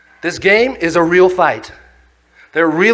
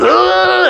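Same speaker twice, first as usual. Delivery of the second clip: hit grunt; 9 semitones higher than usual. Retold or imitated. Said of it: "and this guy's going".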